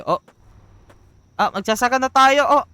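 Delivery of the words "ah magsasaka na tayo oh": high, loud and eager; excited. Real, not acted. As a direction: burst out with excitement. Excited start of farming game.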